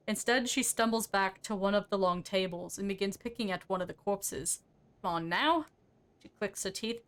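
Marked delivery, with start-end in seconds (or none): 5.0-5.7 coaxing sing-song lilt, rising at the end; lightly sing-song old muttering; sing-song mumbling. Acted. Marta talks to a corpse while pulling teeth, sing-song mumbling. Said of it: "mumbling to herself in a sing-song voice".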